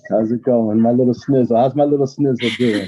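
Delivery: big loud fond hello, pet name beaming; loud affectionate greeting. Real, not acted. Uncle greeting his grown niece, loud and delighted.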